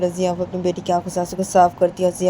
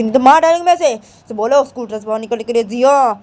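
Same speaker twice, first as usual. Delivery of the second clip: loud, high threatening voice; angry threat; 5 semitones higher, 7 dB louder than usual. Acted. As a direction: threaten to beat the girl. Threatens to beat the child.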